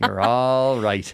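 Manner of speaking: put-on Irish comforting voice; Irish reassurance. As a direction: reassure a child the Irish way, stretching "all". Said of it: "in Ireland, they say this to the children".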